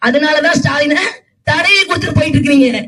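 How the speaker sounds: ordinary talk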